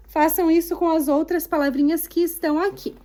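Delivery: normal talking voice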